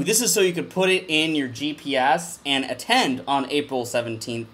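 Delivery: ordinary talk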